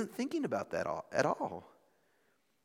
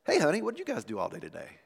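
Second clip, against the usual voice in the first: quick, softer, friendly honest question; 5 dB quieter, faster than usual. Acted. Husband coming home asking wife about her day, honest and warm. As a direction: ask it lightly and sincerely. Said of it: "the other is an honest question".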